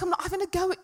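high-pitched stressy protest; stroppy retort. Retold or imitated. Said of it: "getting so stressy and so stroppy".

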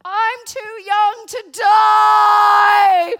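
high, drawn-out panicked scream. Retold or imitated. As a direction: scream it like you're about to be trampled. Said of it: "She starts to scream".

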